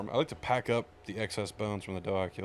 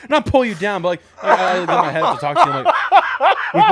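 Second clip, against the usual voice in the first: laughing loudly through words; cracking up while talking; 12 semitones higher, 16 dB louder than usual. Real, not acted.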